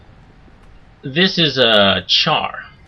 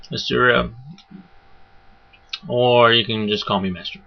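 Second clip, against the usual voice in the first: usual speaking voice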